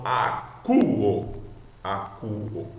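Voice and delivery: "a, cu, o" sounded out with U in "cu". Shown as a demonstration. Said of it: "você sempre lerá cu".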